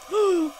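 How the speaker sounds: sharp frightened gasp in; startled gasp. Real, not acted. Scared by a sudden figure, a sharp gasp. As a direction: caught off guard, gasp.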